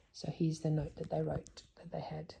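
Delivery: hushed, whispered lead-in; whispered aside. Real, not acted. Reader whispering to child before the letter. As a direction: whisper it as you show the note.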